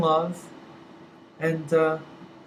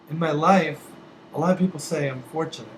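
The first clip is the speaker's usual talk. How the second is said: usual speaking voice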